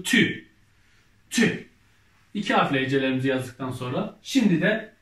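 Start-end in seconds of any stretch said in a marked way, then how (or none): 0.0-1.7 reading syllables aloud. Shown as a demonstration. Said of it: "Ne oldu?".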